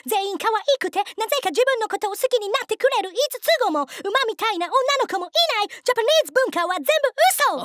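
high-pitched, aggrieved outburst; hammed-up indignation, pitched well above normal; disillusioned rant. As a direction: rant like a let-down foreign fan. Disillusioned tourist rants.

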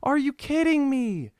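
high, slow anguished protest; real disbelief at failing so close; devastated gamer, high and drawn out; despairing outburst. Real, not acted. Despair after failing challenge.

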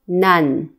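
"nan" closed on a held final n; final-consonant drill. Shown as a demonstration. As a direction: say "nan" loudly and carefully, letting n hum out. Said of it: "七个尾音都发音看看".

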